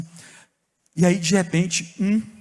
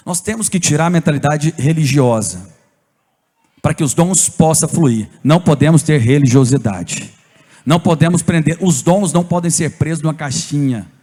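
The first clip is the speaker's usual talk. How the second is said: loud, driving pulpit preaching; full-voiced sermon push; forceful preaching; 4 semitones lower, 7 dB louder than usual. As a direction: hammer the point home to the church. Preacher drives home point.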